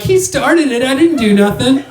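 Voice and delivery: high-pitched plea of innocence; retold indignant self-defense; whiny protest. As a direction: protest like a kid blamed for a fight. Acted protest to security.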